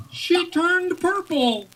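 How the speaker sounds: slow, high, stress on she; dramatic reveal. A narrator's reading. Narrator reveals the chicken's colour, slow and dramatic. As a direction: draw out the reveal slowly.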